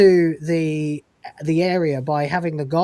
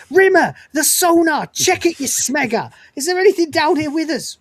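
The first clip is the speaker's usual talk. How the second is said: high, louder shouted order; mock urgent yell; 10 semitones higher, 5 dB louder than usual. Crewmate yelling at Rimmer about the sonar, mock urgent shout. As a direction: bark it up the ladder in a panic, hammed up. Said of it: "he goes".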